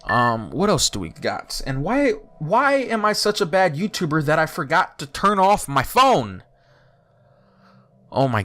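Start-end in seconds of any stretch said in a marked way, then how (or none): 1.7-6.4 high, exasperated wail at yourself; exasperated self-scolding. Real, not acted. YouTuber berating himself for forgetting to turn off the phone, exasperated. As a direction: plead in frustration, pitch raised, stretching the last words.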